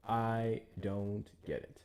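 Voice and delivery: ordinary talk, nothing marked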